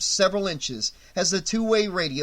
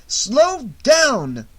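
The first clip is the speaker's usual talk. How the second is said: loud, higher urging; urgent shout; 9 semitones higher, 7 dB louder than usual. A narrator's reading. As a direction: shout it at the driver. Urging driver.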